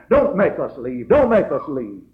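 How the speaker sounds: loud, slow retold wail; mock crying plea. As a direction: beg loudly, hammed-up whimpering. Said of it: "a whimpering and a crying".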